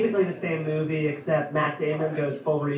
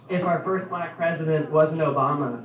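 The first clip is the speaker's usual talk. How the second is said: ordinary talk, nothing marked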